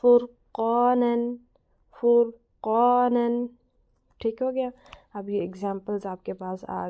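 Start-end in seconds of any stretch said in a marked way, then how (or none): 0.0-3.4 فرقانن drawn out very slowly, for students to repeat; slow Quran recitation. Shown as a demonstration. Teacher asks students to read along, slow recitation. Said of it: "ساتھ ساتھ آپ پڑھا کریں".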